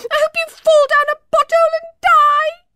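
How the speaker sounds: high, loud angry yell. A narrator's reading. Emma yelling back at Poppy over her shoulder, high and loud. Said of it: "Emma yelled".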